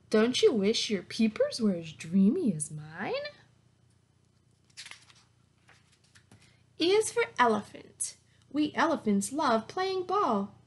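bright, lively picture-book read-aloud; reading to children. Acted. Teacher reading a zoo alphabet book to her class, lively read-aloud. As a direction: read it like story time for little kids.

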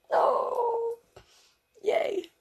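very high squeal of joy; joyful cheer. Real, not acted. Reader cheering the princesses dancing together.

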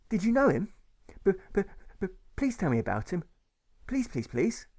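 put-on high, pleading little boy; stammering child. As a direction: beg like a small nervous boy. Boy pleading about his father.

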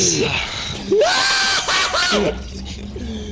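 very high, loud laughing fit; shrieking laughter. Real, not acted. Player still cracking up at Felix, shrieking laughter. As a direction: laugh until it turns into a shriek.

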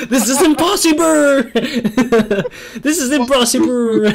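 high, laughing mock disbelief. Real, not acted. Gamer cracking up at the ghost joke, laughing. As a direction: push it up high between bursts of laughter.

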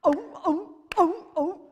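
loud, high seal barks; seal impression. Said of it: "my father's impression of a seal".

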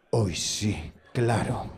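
very low, drawn-out scepticism; dry, low mock agreement; sarcastic agreement. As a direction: drop deep and doubt it. Sarcastic reply to women's answer.

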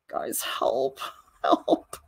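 soft, whispered joking cry for help; whispered mock plea. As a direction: whisper for rescue, half laughing. Mock plea over ruined drawing.

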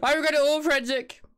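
high loud wail of regret. Real, not acted. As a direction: cry it out dramatically. Regret after a scare.

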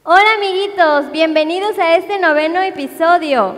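put-on loud cheerful kids' host greeting; big bouncy hello to the children; bright squeaky sing-song welcome. Kids show host greets viewers.

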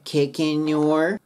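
slow "Kicking your", N carried into "your"; linked N. Shown as a demonstration. Teacher demonstrating the link, slow and joined. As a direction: say "Kicking your" as "keken-nyor". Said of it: "Nの音で乗っかかって".

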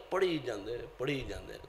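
low, very soft, dying away; fading repetition. Real, not acted. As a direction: let each repeat sink further down. Mocks endless praying, voice fading.

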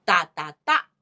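short-short-long 哒哒哒; front-sixteenth rhythm. Shown as a demonstration. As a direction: tap out 哒哒哒, landing on the last. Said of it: "这叫前十六".

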